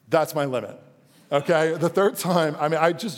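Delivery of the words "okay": ordinary talk, nothing marked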